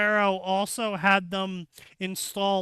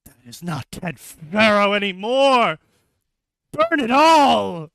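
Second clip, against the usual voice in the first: loud, higher hammed-up order to burn; mock panicked shout; 3 semitones higher, 8 dB louder than usual. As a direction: yell the order, stretching out the last words. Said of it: "he's like".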